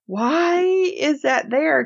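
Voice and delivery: high, slow disbelief; mock incredulous question; acted bafflement. Reenacting own baffled reaction.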